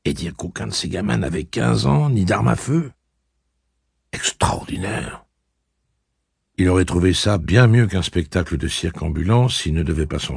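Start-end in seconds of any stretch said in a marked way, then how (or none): 4.1-5.2 murmure lent et étonné; chuchoté. Acted. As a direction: souffle le mot pour soi. Pensée de Jonas chuchotée. (Jonas s'émerveille en chuchotant)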